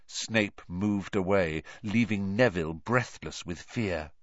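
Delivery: ordinary delivery, nothing marked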